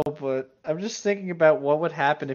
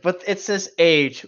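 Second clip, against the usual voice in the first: slow, louder and higher disbelief; incredulous; 4 semitones higher, 6 dB louder, slower than usual. Real, not acted. Reading the cat girl's age aloud, slow disbelief. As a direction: drag it out in disbelief.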